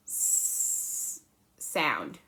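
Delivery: "s" stretched into a long hiss; drawn-out s like a snake. Shown as a demonstration. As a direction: hold the "s" like a hissing snake. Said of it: "Kinda like a snake".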